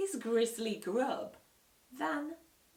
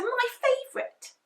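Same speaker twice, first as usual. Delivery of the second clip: put-on high, loud goody-goody child; Peter's sweet voice; 9 dB louder than usual. A narrator's reading. Peter delighting in dinner, high and sweet. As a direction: chirp it like the perfect little brother. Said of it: "said Perfect Peter".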